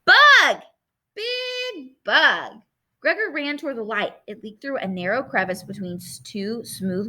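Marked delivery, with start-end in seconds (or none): 0.0-2.5 put-on high-pitched little child calling out; excited toddler cry. A narrator's reading. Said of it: "cried Boots again".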